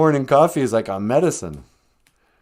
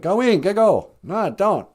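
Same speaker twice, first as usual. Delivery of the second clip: a hint of a grandma scolding a child, pitched up; 8 semitones higher than usual. Buddy imitating his grandma scolding him as a kid. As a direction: shoo the kid off in a mild mock scold. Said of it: "she was like".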